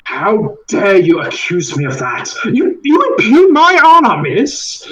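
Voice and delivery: indignant, rising to a high loud cry; a wronged man defending his honor, pitched high and loud; outraged denial. Acted. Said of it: "Ethan just stands up and is like".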